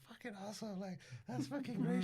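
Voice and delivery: laughing cashier. Retold or imitated. Mimicking the gas station lady still laughing. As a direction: play the amused lady at the till. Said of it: "she's still laughing".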